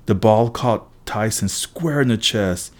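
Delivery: ordinary delivery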